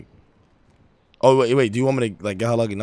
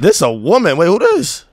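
surprised; 6 semitones higher, 8 dB louder than usual. Real, not acted. Surprised a woman answers.

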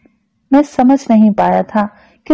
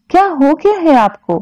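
higher-pitched, troubled question; flustered; 6 semitones higher than usual. A narrator's reading. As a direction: ask in mild bewilderment. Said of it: "वो बुरी तरह उलझ गई".

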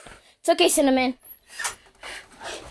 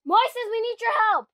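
calling out; 11 semitones higher than usual. Real, not acted. Calling out for help.

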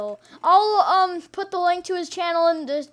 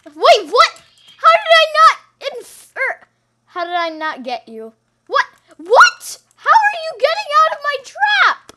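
disbelief; 8 semitones higher, 7 dB louder than usual. Real, not acted. Outraged the target escaped.

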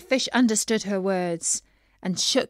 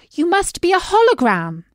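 higher, louder sudden guess; excited princess; 8 semitones higher, 8 dB louder than usual. A narrator's reading. Princess sure the fish is a hologram. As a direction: blurt it out, pleased with the idea. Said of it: "princess guesses".

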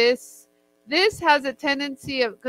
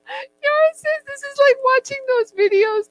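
much higher, mock voice of a friend; mimicking Yara; 4 dB louder than usual. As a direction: parrot Yara back in a put-on high voice. Repeats Yara's words in higher voice.

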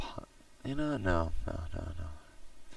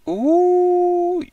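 high, loud squeal of delight; a sudden thrilled ooh; startled gamer squeal; excited squeal; 11 dB louder than usual. Real, not acted. Reacts to a shove in game.

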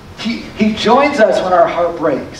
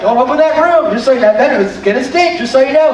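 higher-pitched comic caution; playful heads-up in a raised pitch; mock warning; 4 semitones higher than usual. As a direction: warn them off like a parent. Parent warning about teen boys' room.

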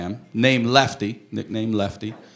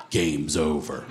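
low, soft pronouncement; understated verdict from a tired father; deadpan dad; 8 semitones lower, 5 dB quieter, slower than usual. Acted. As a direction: drop low and quiet, utterly final. Acts dad's deadpan verdict.

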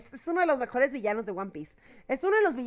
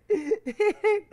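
bursting laugh; 7 semitones higher, 4 dB louder than usual. Real, not acted. Laughing at own skipped list.

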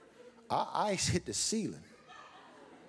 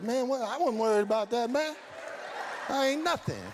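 mock nonchalance; 11 semitones higher than usual. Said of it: "We come out of trouble like".

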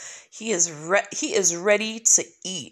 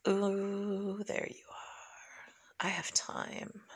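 slow breathy whisper; 2 semitones lower, 12 dB quieter, much slower than usual. Real, not acted. Gamer whispers spotting the shark. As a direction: hush while sneaking up.

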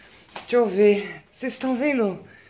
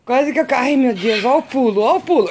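higher and louder, flustered; startled excitement; 4 semitones higher, 6 dB louder than usual. Real, not acted. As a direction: yelp while nearly toppling. Nearly falling, cat jumping.

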